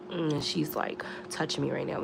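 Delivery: soft, breathy aside under her breath; whispered aside. Real, not acted. Whispering to viewers as the cat touches her. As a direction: drop to a whisper mid-story.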